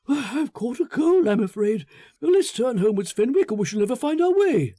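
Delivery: high, pressing voice of a man eager to leave; anxious Foster. Acted. Foster pleading to go home.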